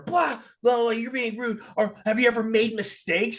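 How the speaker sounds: high-pitched retold outburst; hammed-up indignant rant, high; mock flipping out. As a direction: imitate someone flying off the handle. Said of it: "She flips out some more".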